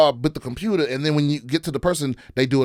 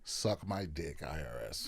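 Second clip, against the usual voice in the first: much lower and far softer, under the breath; low muttered curse; 12 semitones lower, 19 dB quieter, slower than usual. Real, not acted. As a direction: mutter the insult as a throwaway. Dismissive punchline at the IRS.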